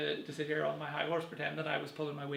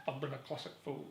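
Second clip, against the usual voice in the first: imitating Alan Rickman; 2 semitones lower, 9 dB quieter than usual. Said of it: "and he says".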